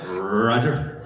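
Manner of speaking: very low mock pilot "Roger". Nervous passenger answering the pilot, in deep pilot talk. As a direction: drop way down and play at being a pilot. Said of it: "if I don't talk like a pilot".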